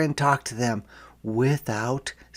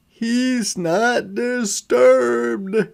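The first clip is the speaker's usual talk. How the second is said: slow, much higher, insistent parents' denial; parents protesting; 10 semitones higher, 3 dB louder, much slower than usual. A narrator's reading. Parents to teachers, defending their son, slow and high. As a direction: stretch every word, defending the child. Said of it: "my parents say".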